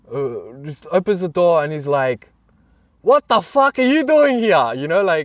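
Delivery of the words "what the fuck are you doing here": high, raised-voice mock outburst; imitated hostile challenge. Australian man opening the door on a stranger, hostile challenge. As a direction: challenge an intruder, hammed up. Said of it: "like, literally like this".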